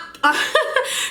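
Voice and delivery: high-pitched burst of laughter; amused laugh. Real, not acted. Wife cracking up retelling her husband's retort, amused laugh. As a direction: crack up mid-story, high.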